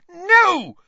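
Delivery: one very high, very loud scream. A narrator's reading. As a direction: scream the refusal out. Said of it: "Hermione screamed".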